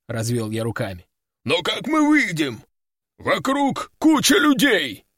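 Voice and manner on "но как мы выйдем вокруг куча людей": higher, slow anxious demon buffalo; worried buffalo. A narrator's reading. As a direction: fret like a big dumb beast. Buffalo demon asks worried question.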